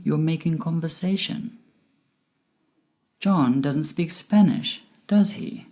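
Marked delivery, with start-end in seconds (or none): 3.2-5.7 "does he?" falling at the end; falling intonation. Shown as a demonstration. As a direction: drop the pitch on "does he?", only seeking agreement. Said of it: "My voice went down".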